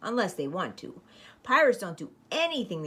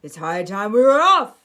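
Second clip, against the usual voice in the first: louder pirate proclamation; pirate captain announcing; 6 semitones higher, 10 dB louder than usual. Acted. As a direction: announce it to the whole ship. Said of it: "he announced".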